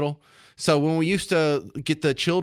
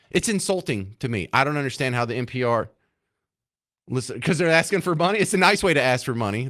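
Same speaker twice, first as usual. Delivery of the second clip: ordinary talk, nothing marked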